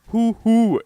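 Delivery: high, loud two-note owl hoot. Shown as a demonstration. Streamer imitates an owl for chat, high hooting. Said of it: "en svensk uggla kanske låter hoo hoo".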